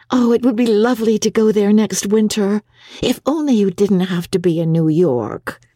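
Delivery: brightly wistful reply; May, cheerful. Acted. Young wife replies to husband about Italy, lightly cheerful. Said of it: "had smiled cheerfully and replied".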